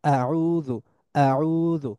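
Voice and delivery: আউযু started abruptly, middle vowel held; recited Arabic আউযু with a sudden first vowel; hamza onset example. Shown as a demonstration. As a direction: open আউযু on a glottal catch. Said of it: "এরকম শুরু হয়".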